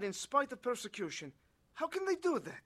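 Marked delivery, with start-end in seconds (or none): 1.7-2.6 baffled, high-pitched appeal for an answer; earnest wondering question. Acted. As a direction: pitch leaps high in disbelief. Convert puzzled by believers' endurance.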